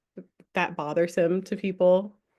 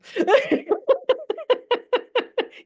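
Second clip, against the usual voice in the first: high laugh; 5 dB louder than usual. Real, not acted. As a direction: burst of high delighted laughter. Bursts out laughing after cheering Siobhan.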